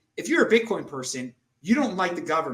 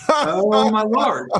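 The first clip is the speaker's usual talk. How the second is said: drawn-out, louder Oh my Lord, stretched slow; exasperated groan; 5 semitones higher, 7 dB louder, much slower than usual. Real, not acted. Telling the guest about the doctor, slow exasperated disbelief. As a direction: let the disbelief sag out of every word.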